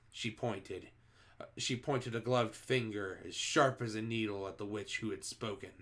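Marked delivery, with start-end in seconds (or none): none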